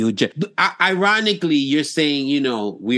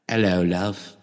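low, lightly done English flirt; soft-edged English accent, pitched down; 4 semitones lower, 4 dB quieter, slower than usual. Acted. English guy greeting a chick, lightly accented. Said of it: "if you're a chick and you hear".

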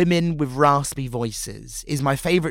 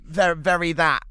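normal talking voice

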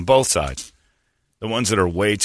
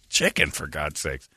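ordinary delivery

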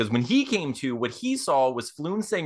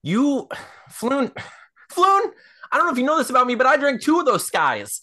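high, loud, alarmed voice; acted panic; 8 semitones higher, 3 dB louder than usual. [name] tells friend he drank it, high acted panic.

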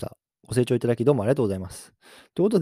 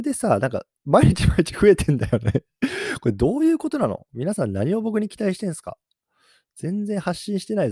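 amused surprise; 9 semitones higher, 5 dB louder than usual. Real, not acted. Baffled by rising follower count.

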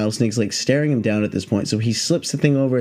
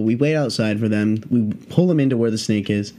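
ordinary delivery

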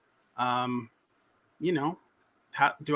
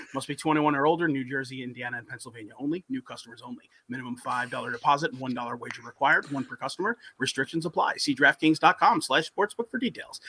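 fast disclaimer; 4 semitones lower, faster than usual. Shown as a demonstration. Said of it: "fast legal disclaimer at ad end".

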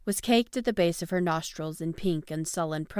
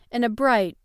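usual speaking voice

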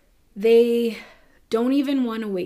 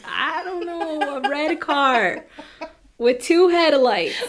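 high, slow, laughing through a clueless witness; 7 semitones higher, 6 dB louder, slower than usual. Retold or imitated. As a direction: giggle out a useless description. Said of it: "I would be like".

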